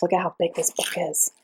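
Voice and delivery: awed hushed whisper; unvoiced whisper of amazement. Real, not acted. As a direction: breathe it out in wonder. Awed at the huge book.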